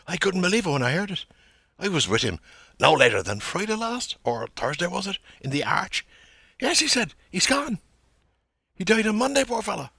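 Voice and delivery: high, loud, fast dramatic news-telling; stagey Dublin gossip. Acted. McCoy delivering the death news to Bloom, high and loud. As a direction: play the news bearer as if on stage. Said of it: "parts played by the conveyor ... on stage".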